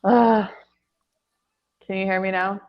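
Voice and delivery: ordinary talk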